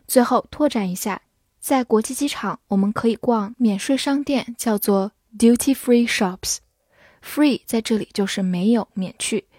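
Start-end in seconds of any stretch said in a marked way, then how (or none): none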